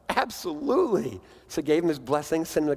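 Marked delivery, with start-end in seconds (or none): 0.1-1.2 high, bright quick agreement from the pastor; eager yes. Retold or imitated. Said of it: "And the pastor's like".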